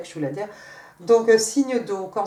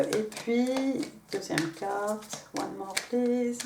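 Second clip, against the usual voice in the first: softer, slow, pulled back; toned down; 7 dB quieter, much slower than usual. Real, not acted. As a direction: drop the voice to calm things down. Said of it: "on va baisser d'un ton".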